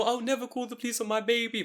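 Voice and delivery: high, sugary put-on voice; a hint of a sappy mum; mock doting parent. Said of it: "people go".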